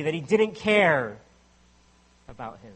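usual speaking voice

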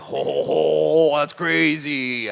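excited. Retold or imitated. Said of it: "I got excited".

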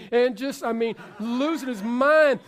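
normal talking voice

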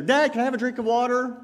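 put-on child's request. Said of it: "A little bit later".

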